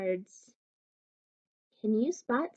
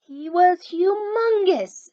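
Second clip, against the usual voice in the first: booming, much higher and louder; big monster voice; 8 semitones higher, 7 dB louder than usual. A narrator's reading. Maxwell tells his chums how big the monster was, booming. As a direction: swell it up huge and dramatic. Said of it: "said Maxwell".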